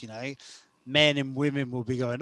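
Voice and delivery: ordinary talk, nothing marked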